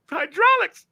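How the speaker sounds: high, strained yelp; mock pained squeal. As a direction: squeal it as if painfully pinched. Retells getting painfully caught in window.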